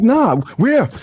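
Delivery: high, alarmed question; mock panic. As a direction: act out being spooked. Acts own panicked reply.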